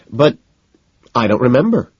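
mimicking her. A narrator's reading. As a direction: parrot her words back mockingly. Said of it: "I say, mimicking her".

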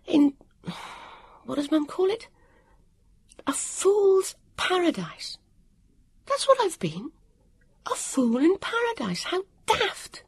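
high-pitched, breathless dismay in short bursts; panting. A narrator's reading. Vivian scolds herself after her weak run, panting and high. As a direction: gasp it out to yourself, high and upset. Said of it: "Vivian panted to herself".

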